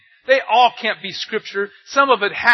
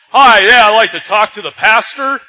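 loud, raised-pitch caller, brash and sure; caller's voice; 13 dB louder than usual. Retold or imitated. Caller phoning the church, loud and self-assured. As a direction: play the know-it-all caller, loud and cocky. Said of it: "when he said".

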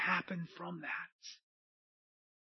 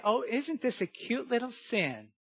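cutesy mock voice; 6 semitones higher than usual. Preacher mimicking someone excusing a little sin, cutesy mock voice. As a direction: put on a cute little voice, mocking. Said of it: "Why am I talking like this?".

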